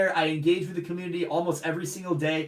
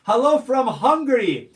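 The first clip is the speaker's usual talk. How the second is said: louder, higher, bright; called greeting; 5 semitones higher, 7 dB louder than usual. Real, not acted. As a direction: call the greeting out. Reading Hungarian greeting.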